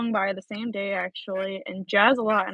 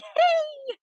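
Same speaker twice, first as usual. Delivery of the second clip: fake cheerful hey. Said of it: "And I'm like".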